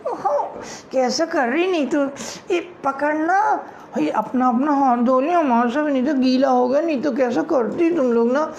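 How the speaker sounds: put-on high flustered girlish voices; flustered schoolgirls; panicked girls. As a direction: hurry away nervously.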